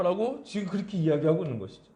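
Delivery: usual speaking voice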